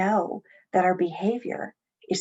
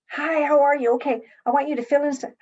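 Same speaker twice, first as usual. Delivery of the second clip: higher, louder, fast and businesslike; brisk clinical greeting; 6 semitones higher, 4 dB louder, faster than usual. Shown as a demonstration. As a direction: rush the intake like a busy clerk. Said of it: "I don't start with".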